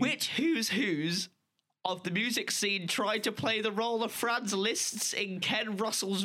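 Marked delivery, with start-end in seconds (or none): 0.0-1.3 lightly voiced old lady, slow start; a hint of a doddery granny. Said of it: "do it in the old lady voice that we had".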